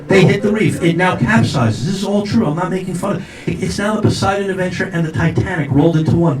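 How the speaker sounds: ordinary delivery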